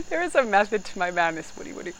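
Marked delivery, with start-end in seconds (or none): none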